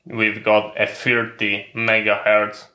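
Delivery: ordinary delivery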